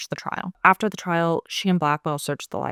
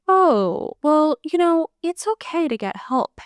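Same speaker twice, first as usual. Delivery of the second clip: awkwardly reassuring; 10 semitones higher, slower than usual. Acted. Retold gentle reply from Blackwell.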